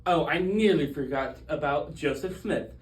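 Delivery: ordinary talk, nothing marked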